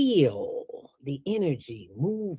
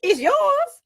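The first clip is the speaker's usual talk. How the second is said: very high, hammed-up disbelief; a doubter mocking the idea of talking to your brain; incredulous mock skeptic; 7 dB louder than usual. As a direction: play the doubter squealing at the idea. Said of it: "have a conversation ... with your brain".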